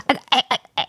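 short, halting mock groans of aching muscles; imitated wailing groans. Showing stiff people getting up, mock pained groans. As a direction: series of small creaky groans, imitated. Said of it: "이건 기합 소리가 아니라 곡소리네".

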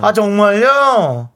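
high, loud, stretched-out cutesy reaction; cute overreaction. Acted. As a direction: play up an adorable, astonished reply. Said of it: "한번 귀엽게".

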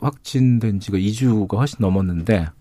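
rough, hoarse voice from illness; hoarse throat after covid. Real, not acted. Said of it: "제가 좀 잠겨 있죠".

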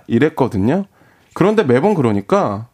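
ordinary talk, nothing marked

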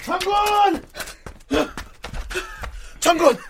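calling out. Acted. Soldier calls out to general.

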